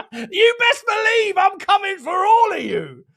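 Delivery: high, slow, shouted through laughter; laughing taunt. Acted. Streamer laughing at rival fans, shouted laughing taunt. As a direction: cackle the threat at everyone.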